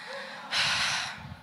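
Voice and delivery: long, high, airy exhale; deflated sigh. Acted. Walker finding the shop closed, deflated sigh. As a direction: let all the hope out in one sigh.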